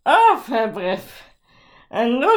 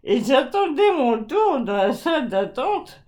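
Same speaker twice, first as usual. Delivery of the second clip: normal talking voice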